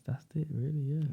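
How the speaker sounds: usual speaking voice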